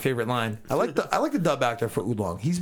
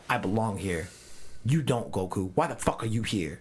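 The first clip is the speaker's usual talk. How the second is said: low menacing Beerus; 4 semitones lower, 3 dB quieter than usual. Retold or imitated.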